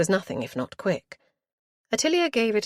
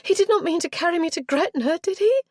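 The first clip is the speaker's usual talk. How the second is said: high, louder shocked question; alarmed gasp; 13 semitones higher, 5 dB louder than usual. A narrator's reading. Girl realising she was nearly carried to Gretna, alarmed. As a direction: gasp it out in dismay. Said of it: "Richenda gave a gasp".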